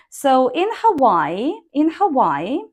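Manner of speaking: in Hawaii with stress on in and a rise; rising tone. Shown as a demonstration. Teacher demonstrating rising tone to students. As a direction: punch in, lift Hawaii. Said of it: "you can use rising tone here".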